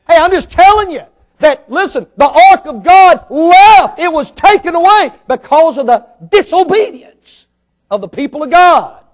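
loud, high preaching climax; full-force preaching. Acted. Preacher warning the congregation, loud and high. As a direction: lift the voice and preach it hard.

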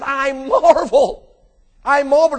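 loud, high, drawn-out amazement; marveling. Retold or imitated. Preacher voicing Jesus at the faithless townspeople, loudly marveling. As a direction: act out astonishment, stretching MARVEL. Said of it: "what I'm saying, he said".

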